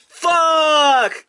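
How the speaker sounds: a loud, high yell of frustration; frustrated shout. Real, not acted. Collector pulls the character he didn't want, loud frustrated shout. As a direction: let the disappointment explode in one word. Last card is the wrong one.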